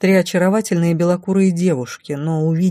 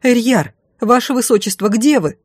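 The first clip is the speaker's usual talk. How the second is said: high, anxious searching shout; raised urgent cry; desperate calling; 6 semitones higher, 3 dB louder than usual. A narrator's reading. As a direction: call for the lost prince. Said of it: "закричала я".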